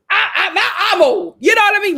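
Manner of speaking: loud, high laughing outburst; laughing shout. Real, not acted. Laughing loudly about feeling old, real laughing shout.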